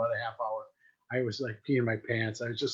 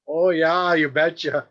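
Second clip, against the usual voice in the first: loud, hammed-up Minnesotan accent; 3 semitones higher, 7 dB louder, slower than usual. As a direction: belt it out in mock Minnesotan. Imagined Minnesotan graffiti quote.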